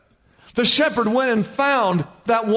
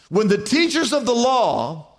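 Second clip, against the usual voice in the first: usual speaking voice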